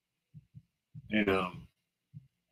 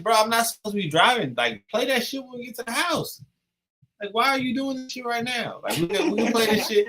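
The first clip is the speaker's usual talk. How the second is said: exasperated pleading; 10 semitones higher than usual. Retold or imitated. Exasperated retold argument with girl.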